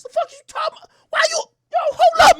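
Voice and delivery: very high, loud strained yell; outraged cry. Retold or imitated. Narrator shouting in outrage at the guy pouring liquor. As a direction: cry out in disbelief.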